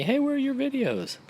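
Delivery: high, pushy quoted viewer; nagging viewer voice. Retold or imitated. Dad imitating viewers asking where the videos are, in a high nagging voice. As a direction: put on a high nagging fan voice.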